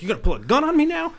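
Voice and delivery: high, fast, loud imitation; mock accusation. Imitating the coworker accusing him, high and agitated. Acts out attacker's wild accusation.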